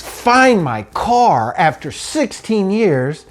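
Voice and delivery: high, pained and slow; the ache of losing it again. Real, not acted. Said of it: "So I'm upset".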